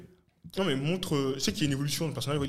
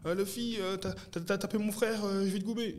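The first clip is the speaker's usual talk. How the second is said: character voice, pitched up, overexcited; voix de personnage; 7 semitones higher than usual. Retold or imitated. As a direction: play the cartoon villain, pitched high. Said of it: "il fait". (voix de méchant de dessin animé, aiguë)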